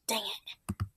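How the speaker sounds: unvoiced defeated whisper; whispered curse. Real, not acted. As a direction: breathe the curse out. Muttered defeat.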